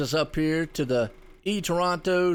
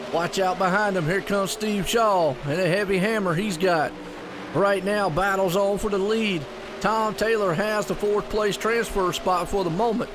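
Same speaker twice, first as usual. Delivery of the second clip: excited, higher live race call; race play-by-play; 4 semitones higher than usual. Acted. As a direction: call the race lap by lap with energy.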